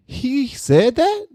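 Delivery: very high, drawn-out question; shocked disbelief. Real, not acted. Host doubting a quote he just read, shocked. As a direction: stretch it out high, unable to believe it.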